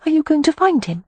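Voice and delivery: put-on high, worried girlish question; anxious young woman. A narrator's reading. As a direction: ask it anxiously, pitched high. Poppy voiced high.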